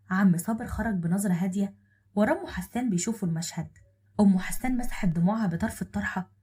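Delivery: ordinary delivery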